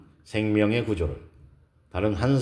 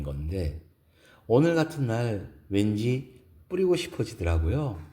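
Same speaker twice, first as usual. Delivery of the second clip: higher, gentle feminine voice; lifted, wistful woman's voice; pleasantly surprised woman character; 4 semitones higher than usual. Acted. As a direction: voice a woman confiding about her perfume. Narrator voices woman about perfume.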